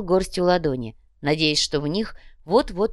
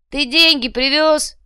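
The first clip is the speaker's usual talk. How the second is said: high, loud, drawn-out shout; impatient heckle; 9 semitones higher, 6 dB louder, slower than usual. A narrator's reading. Impatient back rows demand money from the speaker, heckling. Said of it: "не выдержали в задних рядах".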